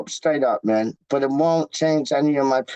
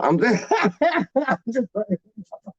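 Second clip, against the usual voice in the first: laughing through the words; 4 semitones higher than usual. Real, not acted. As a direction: chuckle as you admit the joke. Laughs after fake clueless question.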